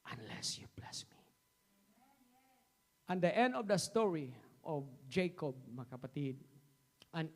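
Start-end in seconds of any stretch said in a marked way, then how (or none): 0.1-1.2 soft unvoiced plea; hushed prayer for blessing; whispered. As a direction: breathe the plea. Whispered end of quote.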